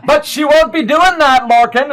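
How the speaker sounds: loud, high warning to Lorcan; scolding. Acted. Dwarf woman scolds Lorcan.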